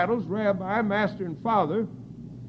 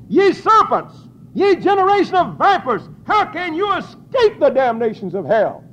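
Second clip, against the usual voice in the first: full-force preaching; 9 semitones higher, 9 dB louder than usual. Acted. Preacher roaring Christ's curse on the vipers, full-force preaching. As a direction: roar out serpents and vipers.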